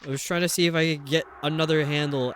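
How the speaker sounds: ordinary talk, nothing marked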